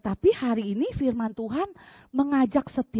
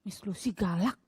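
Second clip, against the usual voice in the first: sulky pupil; 5 semitones lower, 4 dB quieter than usual. Retold or imitated. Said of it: "dia langsung komentar lagi".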